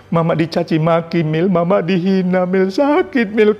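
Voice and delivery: building to a high, piercing wail; anguished shout. Retold or imitated. Mother crying out how much it still hurts. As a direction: let the pain climb to a scream. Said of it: "ibu saya berteriak".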